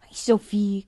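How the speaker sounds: ordinary delivery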